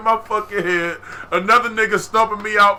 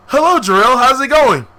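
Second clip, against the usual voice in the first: high loud bright greeting; cheery teacher; 5 semitones higher, 6 dB louder than usual. Retold or imitated. A teacher greeting her student, a high loud cheerful hello. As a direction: sing out a friendly hello.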